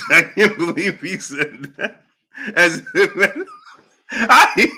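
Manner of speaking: words breaking up into laughter; helpless laughter. Real, not acted. Host unable to believe Bob said it, cracking up. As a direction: laugh through your disbelief. Still overcome by the remark.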